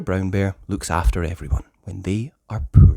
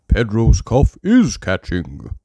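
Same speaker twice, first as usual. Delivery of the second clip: Doctor Brown Bear; 6 dB louder, slower than usual. Acted. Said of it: "says Doctor Brown Bear".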